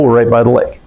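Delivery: ordinary talk, nothing marked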